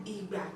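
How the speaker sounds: "Igba" low tone on both syllables. Shown as a demonstration.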